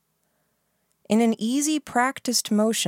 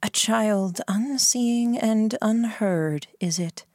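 slow, cruel mocking; drawn-out cruel taunt; 5 semitones higher, slower than usual. A narrator's reading. Baron taunts the wounded narrator.

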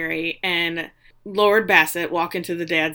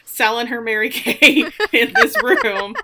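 louder words through a laugh; 3 semitones higher, 5 dB louder than usual. Real, not acted. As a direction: chuckle while you say it. Laughing at the poor maid.

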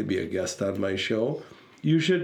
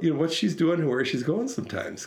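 usual speaking voice

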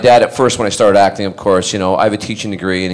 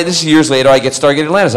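ordinary talk

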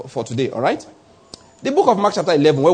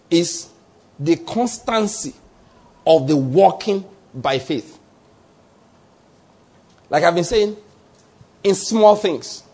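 slow emphatic; 2 semitones higher, slower than usual. Acted. Preacher slowing for emphasis.

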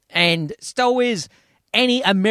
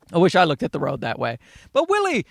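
ordinary delivery

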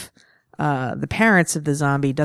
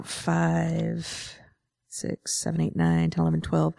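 counting under breath; 6 dB quieter than usual. Real, not acted.

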